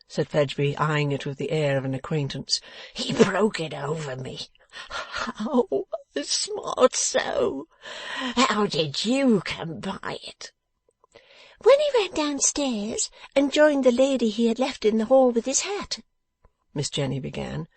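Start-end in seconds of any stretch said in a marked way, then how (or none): 2.9-10.5 slow, pained whine rising to a high moan; aggrieved, beaten victim. Acted. Victim asking the dressmaker about the cane, whining in pain. 11.6-16.0 high, piping, loud scolding voice; sharp little dressmaker. Acted. Dressmaker questioning the beaten man, sharp and high.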